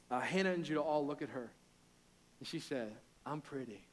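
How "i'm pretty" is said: softer, imitating a little girl's sassy brag; a mock strut in two words; toddler swagger. Dad imitating his daughter's boast, soft playful swagger. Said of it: "Like, a little bit of swagger".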